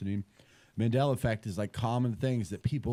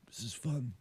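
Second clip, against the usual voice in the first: raspy wispy voice; 8 dB quieter than usual. Retold or imitated. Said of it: "Raspy, wispy voice".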